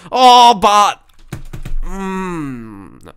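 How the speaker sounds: high, loud cry cut off into a hum; stifled outburst. Real, not acted. Player who almost swore, outburst stifled into a hum. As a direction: start to blurt it, then hold it in. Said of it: "I just kept it in".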